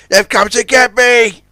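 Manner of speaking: high, loud panicked cry; Zoran crying out. A narrator's reading. Zoran thinks ghosts have come for him, panicked. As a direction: cry out in terror. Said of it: "cried Zoran".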